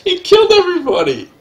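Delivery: high, loud exasperated shouting; loud disbelief. Real, not acted. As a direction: yell in laughing despair. Reacting to board wipe.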